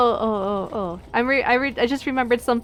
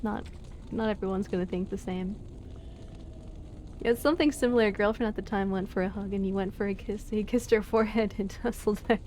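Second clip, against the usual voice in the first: normal talking voice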